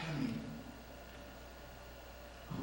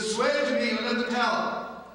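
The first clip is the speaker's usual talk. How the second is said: loud, high pleading; raised urgent demand; urgent plea; 9 semitones higher, 10 dB louder than usual. Acted. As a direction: make him swear, pressing hard.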